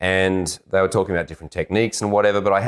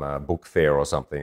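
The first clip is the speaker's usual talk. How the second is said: ordinary talk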